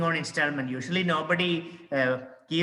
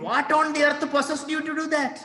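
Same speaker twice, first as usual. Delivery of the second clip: incredulous husband; 8 semitones higher than usual. Acted. Teacher performs husband's line.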